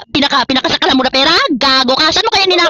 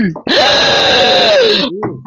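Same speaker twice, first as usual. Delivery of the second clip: long, high mock-crying wail; 9 semitones higher than usual. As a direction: hold a high, exaggerated whine. Mocks rival as crying, pitiful.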